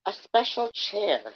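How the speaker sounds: high, slow girlish mimicry; mock a thirteen-year-old, slowly; mimicking a teen girl. A narrator's reading. Bunny mocking a fan as a young teen girl. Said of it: "perfectly mimicking the speech pattern of a thirteen-year-old girl".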